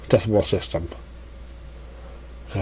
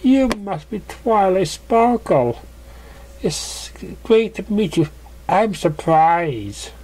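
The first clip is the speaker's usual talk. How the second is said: voiced Pegasus; 8 semitones higher, slower than usual. Pegasus meeting Twilight.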